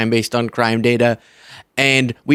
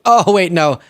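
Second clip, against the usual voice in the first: feigned surprise; 6 semitones higher, 3 dB louder than usual. Pretend. Feigned realization in sarcastic bit.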